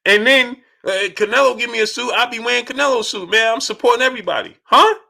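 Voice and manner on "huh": a sharp, much higher, louder "huh?"; jolted into a startled huh; surprised huh. Real, not acted. Streamer to chat, a startled loud huh. Sudden exclaim mid-rant.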